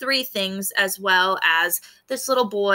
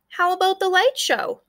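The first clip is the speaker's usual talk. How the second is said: put-on lightly higher kid voice; a child's question; 9 semitones higher than usual. Mackay asks about the light show, light eager voice. Character quote in story.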